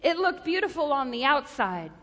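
ordinary talk, nothing marked

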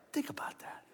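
hushed whisper to the congregation; preacher whispering a point to the church. Real, not acted. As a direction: lean in and whisper it. Preacher drives point home.

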